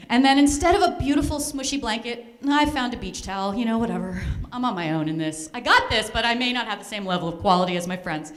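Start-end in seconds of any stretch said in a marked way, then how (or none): none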